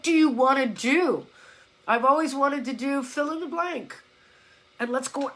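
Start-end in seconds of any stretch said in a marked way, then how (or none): none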